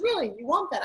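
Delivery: lightly acted, high-pitched questioning; mock surprise. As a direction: feign surprise at a child's request. Mother playfully questions wanting child.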